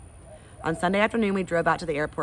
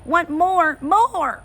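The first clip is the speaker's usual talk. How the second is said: put-on crying toddler; 13 semitones higher, 7 dB louder, much slower than usual. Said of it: "Fudge started to cry".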